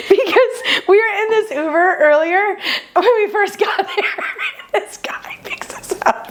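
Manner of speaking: high, wheezing laugh-talk; losing breath laughing, voice squeaking; helpless laughter. Real, not acted. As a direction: squeeze the words out through real laughter. Overcome by laughter while telling.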